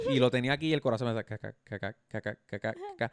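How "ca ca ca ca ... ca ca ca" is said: quick ca ca ca for a racing heart; imitated heartbeat. Telling a friend how his heart raced, a rapid mock heartbeat. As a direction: tap out the heartbeat with the voice.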